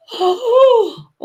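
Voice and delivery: high, drawn-out gasp; loud intake of horror; shocked gasp. Real, not acted. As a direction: gasp at the very idea. Shocked at a vindaloo.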